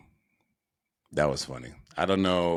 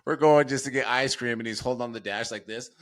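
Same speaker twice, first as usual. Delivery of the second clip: usual speaking voice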